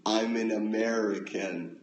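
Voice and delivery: exaggerated, drawn-out American accent from a Brit; off-key American accent, vowels stretched wide; British-doing-American accent. Shown as a demonstration. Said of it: "Your best impression of a British guy doing an American accent".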